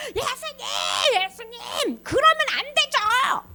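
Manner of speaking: high, drawn-out plea of Peter; hammed-up frantic objection; upset angry protest. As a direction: protest as if shaking him by the shoulders. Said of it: "막 그러면서 화를 냈어".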